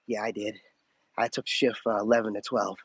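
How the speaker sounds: low, softened voice of a stalker reporting in; mock gang stalker. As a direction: play a shady lurker owning up, low and casual. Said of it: "and be like".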